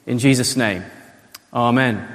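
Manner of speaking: low-pitched, earnest prayer voice; public prayer. Real, not acted. Pastor leads the church in thanks to God, prayerful. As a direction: pray aloud with measured pauses.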